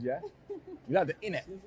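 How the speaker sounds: usual speaking voice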